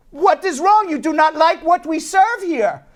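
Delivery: high, indignant owner in stiff broken English; insulted. Retold or imitated. Restaurant owner challenging diners who refuse his food, insulted. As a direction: act out an affronted restaurant owner. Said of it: "He's very insulted".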